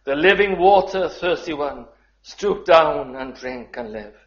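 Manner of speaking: loud, high proclamation of the hymn line; ringing proclamation. Shown as a demonstration. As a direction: call it out to the hall. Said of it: "But the second verse".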